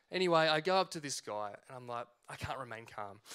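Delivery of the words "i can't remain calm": very soft, low confession to yourself; muttered admission. Retold or imitated. Referee giving up on staying calm, muttered admission. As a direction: drop it right down, as a comic aside. Retold private admission of failing.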